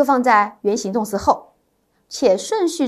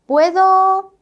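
puedo pitched high and louder, left hanging; fill-in-the-blank prompt; 7 semitones higher, 5 dB louder than usual. Shown as a demonstration. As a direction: stop on puedo to leave the blank. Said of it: "第二题". (提高音调停在puedo留空)

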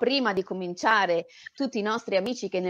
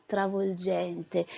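"travolgente" said softer and slow, drawn out; 3 semitones lower, 7 dB quieter, much slower than usual. Acted. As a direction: breathe out "travolgente" slowly and softly. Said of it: "Non l'aveva già detto travolgente?". ("travolgente" piano e lento)

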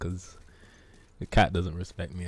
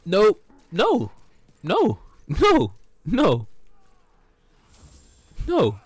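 high, loud repeated no; frantic no; 13 semitones higher, 4 dB louder, much slower than usual. Real, not acted. Streamer refusing a fight, high loud shouts. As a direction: shout no again and again.

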